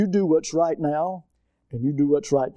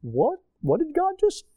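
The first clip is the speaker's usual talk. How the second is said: mock surprise; 10 semitones higher than usual. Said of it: "mock shocked reaction".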